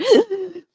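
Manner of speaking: put-on sharp high gasp like a sobbing baby; imitated gasping cry. Nurse imitating a baby's breathless cry, sharp high gasp. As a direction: catch the breath like a crying newborn.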